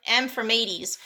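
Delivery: usual speaking voice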